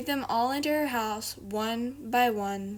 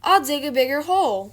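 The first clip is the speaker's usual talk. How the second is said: louder bright plan; eager Rabbit; 3 semitones higher, 7 dB louder than usual. Acted. Rabbit to Douglas, eager and loud. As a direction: announce it keenly.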